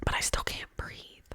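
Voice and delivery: loud, forceful stage whisper; emphatic whisper. Real, not acted. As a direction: push the whisper out hard for emphasis. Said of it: "I can't say that".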